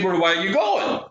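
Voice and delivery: mild acted holler; calling out. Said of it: "So he yells out".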